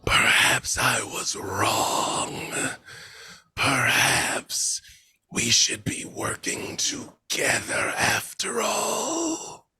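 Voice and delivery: slow, eerie whispered voice of Death; whispering Death. Acted. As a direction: breathe it out like a dread reaper. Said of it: "and says".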